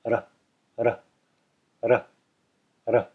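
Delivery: ra with the tongue lightly flicked against the roof of the mouth; tapped ra. Shown as a demonstration. Teacher drilling the Sanskrit ra for students. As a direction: loose jaw, a light single tap for each ra. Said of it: "just lightly thrown against the roof of the mouth".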